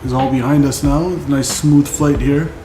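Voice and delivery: ordinary delivery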